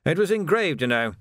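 understated mournful complaint. A narrator's reading. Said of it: "said Tilden mournfully".

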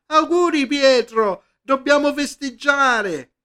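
high, loud call from across the room; shouting from afar. Acted. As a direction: shout your congratulations from afar. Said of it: "Alessandra gli gridava". (grida auguri da lontano)

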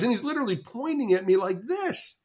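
ordinary talk, nothing marked

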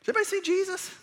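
high, startled cry of realisation; mock-panicked, pitched high; sudden alarm. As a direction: gasp it out like you just lost someone. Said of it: "it's like".

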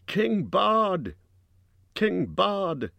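high acclaiming cry; high-pitched chant of acclaim; crowd shout. A narrator's reading. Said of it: "they shouted".

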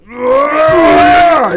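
loud high punching grunt; effort grunt. Retold or imitated. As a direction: grunt like throwing a hard punch. Imitating Batman's punch grunt.

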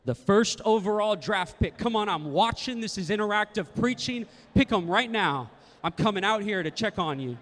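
loud, punchy preacher calls; rally them loudly to pick; hyping the crowd. Real, not acted. Preacher urging the congregation to pick a neighbor, loud.

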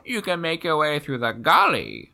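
put-on high, squawking old crow. Shown as a demonstration. Old raven telling the way through the gully, crow squawk. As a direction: caw first, then drag the words out high and slow. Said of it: "like, crow voice".

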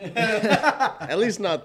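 high, loud, slow words through laughter; laughing. Real, not acted. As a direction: say it while cracking up. Laughing along at the joke.

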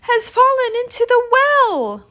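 high, loud breathless burst; gasping. A narrator's reading. Chang tells mother of the well, gasping. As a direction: force out the last words on spent breath. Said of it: "he gasped".

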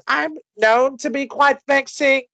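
high, put-on character accent; accented impression, pitched well up; Harley Quinn. Quizmaster repeating the movie quote for friends, in a high put-on accent. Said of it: "I can't do the accent anymore".

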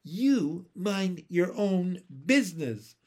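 put-on emphatic little-girl defiance; defiant retort. A narrator's reading. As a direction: push back indignantly, word by word. Defiant child's retort in story.

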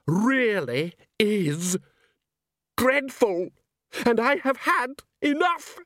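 high, drawn-out theatrical loathing; villain's tirade. Acted. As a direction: build a hammed-up rant, pausing before key words. Salzella rants against opera.

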